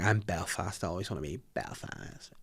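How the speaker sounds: a low, soft take on the wife; wife's voice. Retold or imitated. Husband imitates his wife's complaint, low and soft. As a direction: lightly mimic the wife, low and quiet. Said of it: "She was like".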